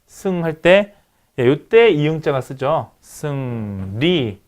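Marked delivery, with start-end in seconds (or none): 3.0-4.4 '승리'를 '승', '리'로 길게 늘여서; '승리'를 낮은 음으로 아주 천천히; 느린 음절별 시범. Shown as a demonstration. 교사가 음절별로 발음 시범. ('승리'를 받아쓰라는 듯 한 음절씩 길게)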